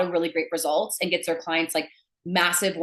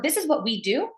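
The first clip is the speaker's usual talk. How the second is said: high, statements turned into questions; upspeak; 8 semitones higher than usual. Shown as a demonstration. Coach mimics sellers pitching their offer, with upspeak. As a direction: lift every phrase ending sharply. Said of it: "go up like that at the end".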